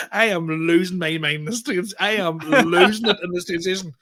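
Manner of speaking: ordinary talk, nothing marked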